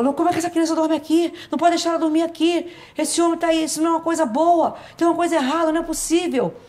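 high, loud alarmed shouting; frightened sister-in-law yelling; panicked scream. Retold or imitated. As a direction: cry out in fright at the child sleeping there. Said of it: "E aí ela gritou".

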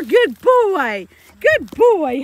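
gushing praise for a good dog; loud, excited praise. Real, not acted. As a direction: cheer the dog on, high and loud.

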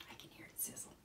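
very soft whispered aside. Acted. Reader confides hearing the pan sizzle, whispering. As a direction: whisper it to the listeners.

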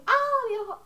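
high, loud mock cry of pain; retold yelp. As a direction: act out getting hit in the eye. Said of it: "y yo".

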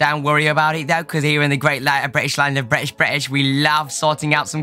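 hammed-up British accent, chatty host; mock British accent. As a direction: put on an over-the-top British accent. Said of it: "I can't do this accent".

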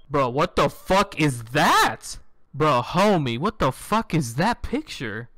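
slow, loud stunned bro what; staring at a creepy picture in disbelief; shocked disbelief. Real, not acted. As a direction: drag it out in disbelief. Shocked at a game image.

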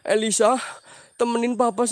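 usual speaking voice

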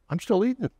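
put-on high, whiny kid complaint; kid's protest. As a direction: plead like a boy who doesn't want to leave dinner.

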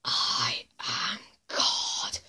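very low, slow, hushed voice; eerie low villain whisper; creepypasta villain. Acted. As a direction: half-whisper it slowly and ominously.

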